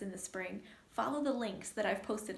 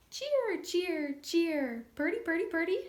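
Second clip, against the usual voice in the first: high, loud bright whistled calls; cardinal song; 6 semitones higher, 5 dB louder than usual. Shown as a demonstration. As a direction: sing it like a cardinal. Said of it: "Cardinal wears a pointy hat".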